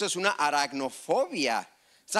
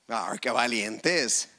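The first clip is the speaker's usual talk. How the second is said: ordinary delivery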